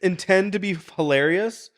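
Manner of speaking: high, laughing words; real laughter, high-pitched; talking through laughter. Real, not acted. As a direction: keep laughing as you talk. Laughing while telling story.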